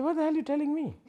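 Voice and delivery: high, teasing disbelief; mock exasperation. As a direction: tease him with mock outrage. Master mocks happy student.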